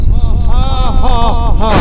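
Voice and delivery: booming evil laugh from the grave; big villain laugh; evil ghostly cackle. Acted. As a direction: cackle loud like a risen spirit.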